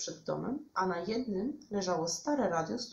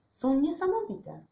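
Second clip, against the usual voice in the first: high, loud marvelling; amazed; 8 semitones higher, 6 dB louder than usual. A narrator's reading. Bear shows Witek the ants, marvelling. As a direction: gush with wonder.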